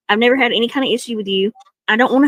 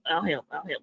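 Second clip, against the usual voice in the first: each repeat much softer, dying to nothing; fading away; 6 semitones lower, 14 dB quieter than usual. Acted. Offering help to Krabby, fading comically away. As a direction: let it drift off into silence.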